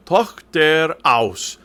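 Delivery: Tochter aus, slow German with a throaty ch; slow diction model. Shown as a demonstration. Coach modelling the text for the choir, slow careful diction. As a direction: stretch out "Tochter aus" word by word. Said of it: "I'll be doing some reading of the text".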